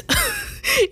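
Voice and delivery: high squealing burst of laughter; a sudden squeal of laughter. Real, not acted. Laughing at her own cookout rant, high squealing laugh.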